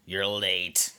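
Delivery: put-on low, sour old woman; old hag voice. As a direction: sneer like a bitter old lady. Gladys voiced by man.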